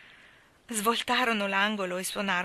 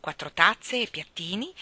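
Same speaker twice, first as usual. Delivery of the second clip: ordinary delivery, nothing marked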